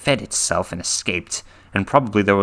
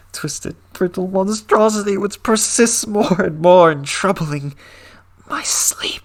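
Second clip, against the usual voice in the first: horrified ending; 10 semitones higher than usual. A narrator's reading. Story's horrified final line.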